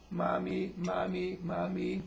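put-on high little baby call; baby's first words. Baby starting out with words for mother, high baby voice. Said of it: "The baby happens to start out with".

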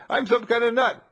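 high, loud bemused man; a puzzled stranger calling him a nut; high mock stranger voice; muddy man's voice. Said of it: "And he says".

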